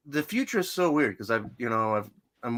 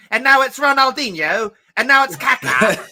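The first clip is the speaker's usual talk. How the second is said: put-on high, loud British commentary accent; hammed-up British accent; mock British commentator; 10 semitones higher, 8 dB louder than usual. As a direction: bellow the names like a British soccer announcer. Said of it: "but the British are like".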